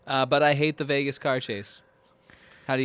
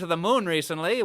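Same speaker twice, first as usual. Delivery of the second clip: ordinary talk, nothing marked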